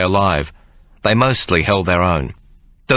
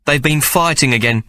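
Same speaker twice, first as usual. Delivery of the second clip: higher motherly voice, a little weary; mother Mary; 6 semitones higher than usual. Acted. Mary complaining to Ike about the boys, higher mother voice.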